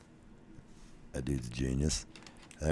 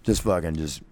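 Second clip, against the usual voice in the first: ordinary delivery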